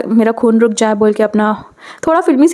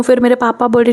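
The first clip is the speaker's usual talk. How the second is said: ordinary delivery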